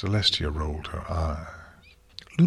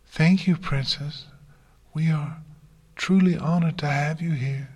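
high, polite character voice; reply politely, pitched well up; high-voiced, courteous thanks; Fluttershy; 8 semitones higher than usual. A narrator's reading. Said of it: "Fluttershy replied politely".

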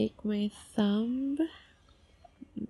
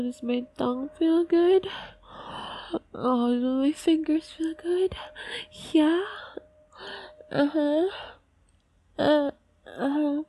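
higher, louder eager whisper; excited teasing; 6 semitones higher, 5 dB louder than usual. Acted. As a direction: push up high and breathless.

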